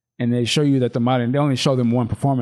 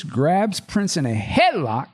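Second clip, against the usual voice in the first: normal talking voice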